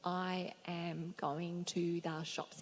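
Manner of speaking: slow, even English example; slow demo of the English word order; slow example sentence. Shown as a demonstration. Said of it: "if you were to say".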